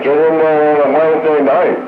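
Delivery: ordinary delivery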